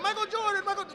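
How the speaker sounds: put-on Italian accent, high and excited. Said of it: "That's my Italian accent".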